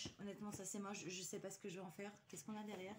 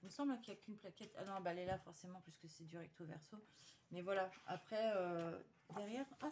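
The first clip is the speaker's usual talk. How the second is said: ordinary talk, nothing marked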